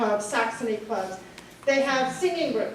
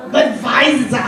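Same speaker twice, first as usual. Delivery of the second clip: Budweiser with a German V, said Budvaiser; mock German accent on Budweiser, the w turned to v; hammed-up German accent saying Budweiser as Budvaiser; bad German accent; 8 dB louder, slower than usual. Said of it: "A really bad German accent".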